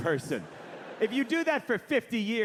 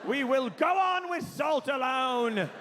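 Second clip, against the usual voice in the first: grand theatrical resolve, higher and building; Patrick Stewart; 5 semitones higher than usual. Retold or imitated. Sir Patrick vowing to go on with salt alone, heroic. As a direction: declaim it, stretching to a heroic shout. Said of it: "And he's like".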